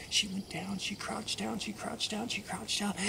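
soft, repeated suspenseful phrases; hushed build-up. A narrator's reading. Storyteller as the mouse crouches to jump, hushed suspense. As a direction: keep it hushed, coiling before a spring. Narrator mimics crouching again.

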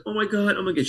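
high-pitched mock choked-up gushing; imitating tearful emotion. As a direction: play her overcome, pitched well up. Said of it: "so she's really sensitive".

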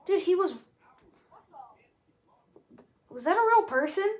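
loud, high disbelieving shout; shocked yelling. Real, not acted. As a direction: cry out in disbelief. Disbelief after dying.

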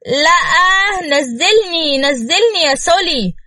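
high, giggly squeal of no, put me down; mock-protest while being carried around; tipsy wife squealing at her husband to put her down; playful protest.

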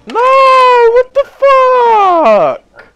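shrieking high and loud in shock; panicked high shriek; startled scream. Real, not acted. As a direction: scream in real fright, drawn out. Startled scream at game event.

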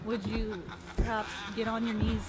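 ordinary delivery

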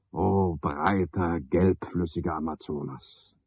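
slow, soft, faraway poetic musing; dreamy recital. Acted. Porter daydreaming of the Amazon, reciting slowly and softly. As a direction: drift off as if gazing at a great river.

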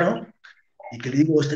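ordinary delivery

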